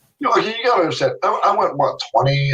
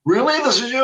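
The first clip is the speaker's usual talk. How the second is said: imitating the friend's disbelief, higher; amazed friend; 9 semitones higher than usual. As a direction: lift it up in mock astonishment. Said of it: "He goes".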